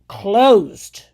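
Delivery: closed barked loud; emphatic. Real, not acted. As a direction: slam the word closed shut. Repeating closed at the critic.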